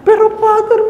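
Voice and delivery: put-on very high, whining elderly complaint with laughs; high, laughing old woman's lament; whiny old lady. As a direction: ham up a grandmother listing her aches.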